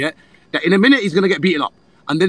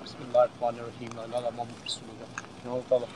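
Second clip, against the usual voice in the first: murmured prayer; 9 semitones lower, 16 dB quieter than usual. Real, not acted. Prayer before the fight.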